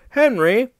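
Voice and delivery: high, lilting call by name; mock-innocent, rising pitch. A narrator's reading. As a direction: open a teasing offer innocently. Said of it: "asked Duck innocently".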